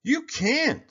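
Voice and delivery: high, loud bully holler. A narrator's reading. Bully Sammy hollering at Kevin. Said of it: "Sammy hollered".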